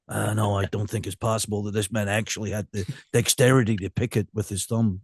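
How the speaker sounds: a light Colombo, puzzling over the evidence; Colombo impression. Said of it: "He'd be like that with a cigar".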